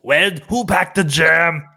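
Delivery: drawn-out mock Austrian Arnold; Arnold Schwarzenegger impression. Friend joins the Arnold picnic bit in a mock Austrian accent. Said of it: "Arnold Schwarzenegger impression at a picnic".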